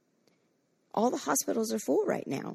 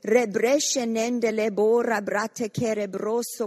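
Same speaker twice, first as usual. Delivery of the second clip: fervent stream of prayer syllables; rising, rhythmic prayer in tongues; praying in tongues. Real, not acted. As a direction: pray it out in tongues, building. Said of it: "let's pray in the Holy Ghost".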